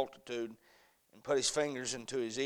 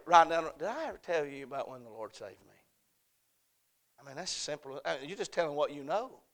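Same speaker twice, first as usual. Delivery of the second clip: ordinary talk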